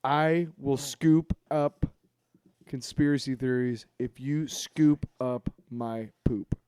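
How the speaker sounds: ordinary talk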